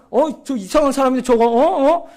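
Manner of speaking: high-pitched mock indignation; retold anger. Youth president grumbling about that strange pastor, retold anger. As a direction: imitate a friend fuming in your defence. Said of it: "그러면서 막 이러는 거예요".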